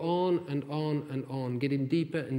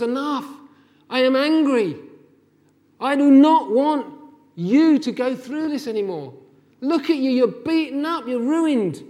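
loud, high, anguished outcry; crying out in anger; 8 semitones higher, 10 dB louder than usual. Acted. God crying out to his wounded people, loud anguished anger. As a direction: cry out with angry, heartbroken love. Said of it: "God cries out to his people".

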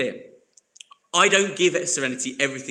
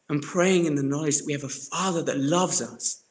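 ordinary talk, nothing marked

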